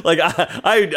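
normal talking voice

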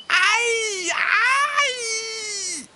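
long, very high yelping "aïe"; pained fox wail, drawn out; howl of pain. A narrator's reading. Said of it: "hurle le renard".

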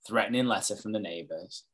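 ordinary delivery

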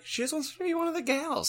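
light, high and quick; high girly voice. Retold or imitated. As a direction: chirp it like one of the gals. Voicing the witch, high fast.

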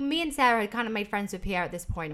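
ordinary talk, nothing marked

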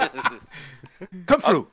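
high, loud lizard order; lizardfolk character. Acted. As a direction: summon them as an impatient creature. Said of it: "he just goes".

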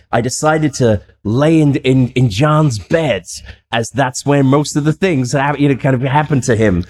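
slow, earnest mock narration; slow Zach Bagans quote. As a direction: drag it out like a solemn TV host. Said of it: "Zach says".

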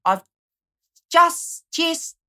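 mock Australian, straining on just; Australian accent. Host testing Australian pronunciation of just, mock Australian. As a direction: hunt for the Aussie vowel in just. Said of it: "how did they say just?".

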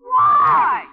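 loud demand. Acted. Twins demanding why.

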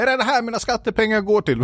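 high-pitched outraged taxpayer impression; mock indignant complaint. Imitating online commenters griping about taxes, mock indignant.

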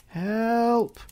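loud, high, drawn-out shout; shouted cry for help. A narrator's reading. Hurt narrator calling to a dog's owner, shouting for help. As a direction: call out for rescue, high and loud. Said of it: "I shouted".

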